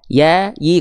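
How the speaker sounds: "ya, yi" with an open y glide; letter ya. Shown as a demonstration. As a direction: raise the tongue without contact for "ya, yi". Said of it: "Kalau huruf ya".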